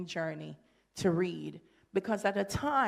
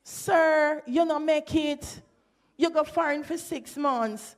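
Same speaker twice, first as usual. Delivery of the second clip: higher, louder patois scolding; a hammed-up Jamaican taunt at the man who failed to get a twang; mock scolding; 7 semitones higher, 4 dB louder than usual. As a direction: scold him in mock outrage. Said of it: "meant that".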